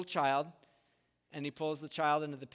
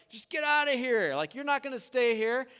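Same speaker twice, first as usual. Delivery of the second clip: raised, louder mock jeer; 7 semitones higher, 5 dB louder than usual. Samaritans jeering at Jesus' disciples, a raised mock yell. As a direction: shout them off like a hostile crowd. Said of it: "you can just imagine that they're jeering at them".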